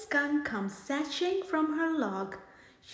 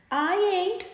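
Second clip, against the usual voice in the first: smirking; 6 semitones higher, 5 dB louder than usual. Acted. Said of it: "smirks Madam Skunk".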